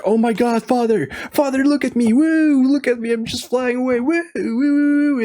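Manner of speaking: put-on thrilled kid showing off to his father mid-flight; giddy falsetto whoops of a boy flying; overexcited boy. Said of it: "gets too excited".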